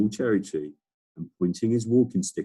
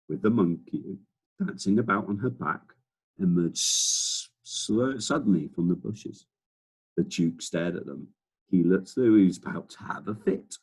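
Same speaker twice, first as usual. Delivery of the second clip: ordinary delivery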